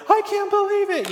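squealing high, mock-starstruck; giddy disbelief. Retweeted by a famous guy, mock-giddy squeal. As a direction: jump way up like a starstruck fan. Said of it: "And I was like".

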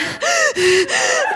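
high, heaving crying breaths; hammed-up hyperventilating sobs; imitated sobbing gasps. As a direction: act out gulping for air mid-sob. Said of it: "And I'm crying".

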